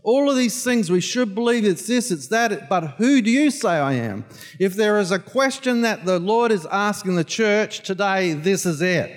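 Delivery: shouted challenge to the church; preaching climax. Acted. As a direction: drive it home like a rallying cry.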